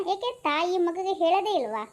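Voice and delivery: ordinary delivery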